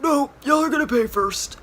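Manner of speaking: high, loud put-on demand; imitating the attendant, high and loud; parking attendant. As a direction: call after them like the stern attendant. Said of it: "he's like".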